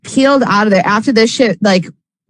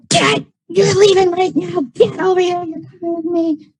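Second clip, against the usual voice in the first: mock angry mom; 8 semitones higher, 3 dB quieter than usual. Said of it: "And she's like".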